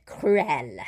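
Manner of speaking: CRUEL ending on a clear L; final L pronounced. Shown as a demonstration. Teacher showing the L is heard, final L pronounced. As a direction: sound the L closing cruel. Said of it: "there's the L".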